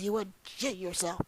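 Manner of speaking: ordinary talk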